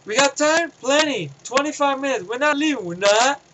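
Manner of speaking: a gung-ho marine, mildly acted; quoting a soldier. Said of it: "he goes".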